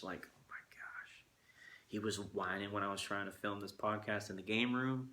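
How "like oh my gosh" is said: whispered, slow and fed up; exasperated whisper. Real, not acted. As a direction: breathe it out, worn out by the whining. Exasperated at whining puppy.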